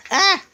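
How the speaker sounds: sudden high yelp; a real little yelp of surprise; startled yelp. Real, not acted. Owner startled as the bird goes for her feet, sharp high yelp.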